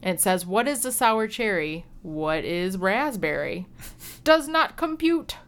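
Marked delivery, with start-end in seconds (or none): 4.2-5.4 robot voice. Pretend. Reading beer label as robot.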